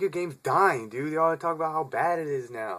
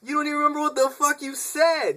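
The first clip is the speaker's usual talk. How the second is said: much higher, mock exasperated disbelief; incredulous inner voice; 4 dB louder than usual. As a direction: squeak it out like you can't believe this guy. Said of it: "you're like".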